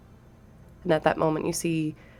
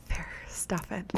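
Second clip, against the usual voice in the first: hushed whisper aside; 8 dB quieter than usual. Real, not acted. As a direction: whisper it under your breath.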